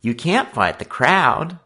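high, slow cautioning voice; urgent, high-pitched warning; interfering warning. A narrator's reading. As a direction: talk a friend out of it. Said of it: "Fred interfered".

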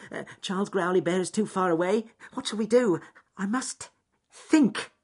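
high-pitched rabbit working out a plan; rabbit character, urgent. Acted. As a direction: think aloud in a high, hurried little voice. Voices anxious rabbit character.

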